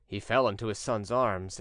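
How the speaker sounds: ordinary delivery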